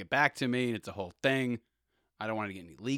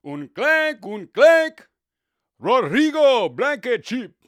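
much higher and louder, name drawn out slowly; Spanish announcer; 14 semitones higher, 18 dB louder, much slower than usual. Retold or imitated. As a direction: call the miss like a Spanish broadcaster. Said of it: "and you hear".

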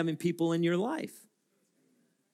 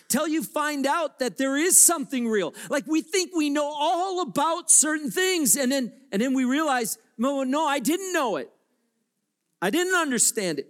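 raised preaching; 10 semitones higher, 3 dB louder than usual. Real, not acted. Sermon rising to full force.

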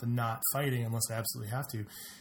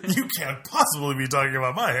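louder, hammed-up outraged disbelief; mock indignation; 7 dB louder than usual. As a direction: play up the offended vanity. Co-host joins the retold reply.

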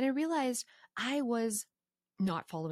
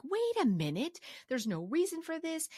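retold realization; 8 semitones higher than usual. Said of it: "I'm like".

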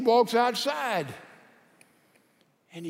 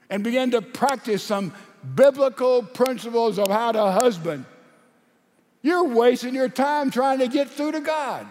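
loud, raised preacher's delivery; full-force preaching; 3 semitones higher, 8 dB louder than usual. Real, not acted. Preacher challenges congregation on God's power, full-force preaching. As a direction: preach it hard, voice lifted on the questions.

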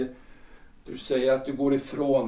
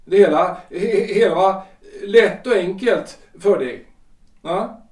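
loud, sarcastic and hurt; a betrayed husband lashing out with scorn; bitter angry outburst; 4 semitones higher, 6 dB louder than usual. Acted. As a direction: throw each question back at her, louder. Husband confronts wife over divorce.

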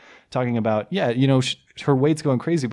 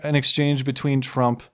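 ordinary talk, nothing marked

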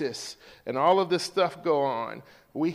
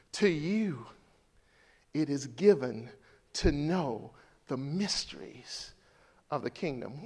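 hushed solemn quote; 5 semitones lower, 6 dB quieter than usual. Acted. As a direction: lean in and say it gently. Quiet solemn quoting of Jesus.

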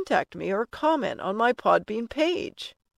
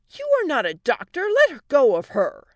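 loud, raised indignant order; angry protest; 6 semitones higher, 7 dB louder than usual. Acted. Wife stopping husband treating daughter, loud and angry. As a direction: forcefully order him to stop.

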